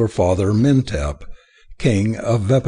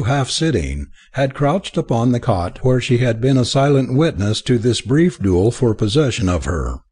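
usual speaking voice

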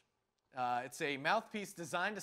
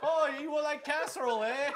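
a hint of Midwest accent, laughing and higher; hammed-up Midwest accent while laughing; mock Midwest accent; 6 semitones higher than usual. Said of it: "the Midwest equivalent".

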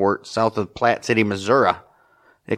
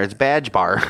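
usual speaking voice

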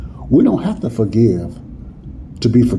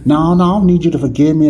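mock refusal; 5 semitones higher, 4 dB louder, much faster than usual. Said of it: "the minute the preacher say".

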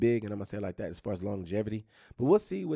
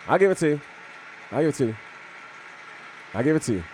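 loud concession; 4 semitones higher, 4 dB louder, faster than usual. Real, not acted. Conceding loudly to rival team.

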